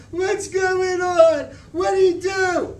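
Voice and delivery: put-on high, loud and slow, a scared girl crying out; a frightened little girl yelling for answers; frightened child. A narrator's reading. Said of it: "She was starting to get jumpy".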